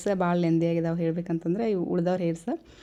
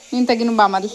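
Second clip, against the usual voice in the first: calling out; 3 semitones higher, 10 dB louder than usual. Real, not acted. Telling child to come first.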